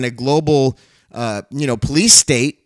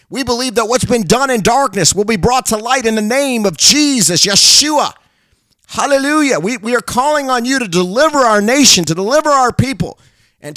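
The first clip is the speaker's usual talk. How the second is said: fervent prayer; 6 semitones higher than usual. Real, not acted. A preacher crying out to God for the nation, fervent. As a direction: cry out to the Lord for the nation.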